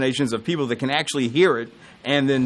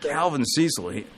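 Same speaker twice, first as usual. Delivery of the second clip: ordinary delivery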